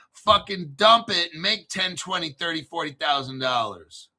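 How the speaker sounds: ordinary delivery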